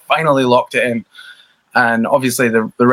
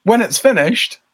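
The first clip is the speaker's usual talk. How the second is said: much higher, put-on voice of a casual label rep; imitating the label guy; 7 semitones higher than usual. Band member mimicking the label guy's reply, high put-on voice. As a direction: take off the label guy with a lifted, offhand voice. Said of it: "the guy was like".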